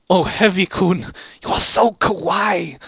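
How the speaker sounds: swooning Medic. A narrator's reading. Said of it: "Medic said".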